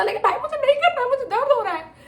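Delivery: put-on very high, whiny childish protest. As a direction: whine like a hurting kid. Whining refusal, pain. (बहुत ऊँची आवाज़ में बच्चे जैसी ज़िद)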